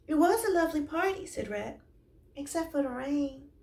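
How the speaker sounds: high, fast polite little voice; a touch of a squeaky rat; lightly voiced Rat. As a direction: Rat politely thanking Sloth.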